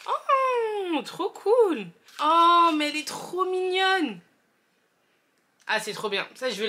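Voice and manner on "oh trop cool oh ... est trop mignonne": high, bright excited gushing; delighted squeal. Real, not acted. Unboxing a cute candle.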